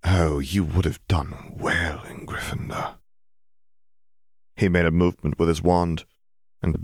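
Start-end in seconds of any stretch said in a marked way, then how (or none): 0.0-3.0 slow, breathy whispered regret; sad whisper. A narrator's reading. Riddle to Bill at the sorting, slow sad whisper. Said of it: "smiled in a sad sort of way".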